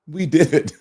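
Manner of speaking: celebratory line breaking into laughter; laughing. Real, not acted. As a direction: say it, then burst out laughing.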